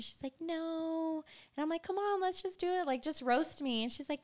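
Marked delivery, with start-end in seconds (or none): 0.4-1.2 higher-pitched drawn-out mock no; reluctant refusal. Acted refusal in retold exchange. 1.8-3.8 higher-pitched playful pleading; coaxing. Retold or imitated. Coaxing friend in retold exchange.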